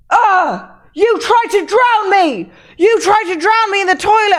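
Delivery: high, loud mock scream of accusation; imitated screaming. Drunk party host accusing a friend of drowning him, screaming. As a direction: imitate a drunk wailing in outraged panic. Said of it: "everyone heard us screaming again".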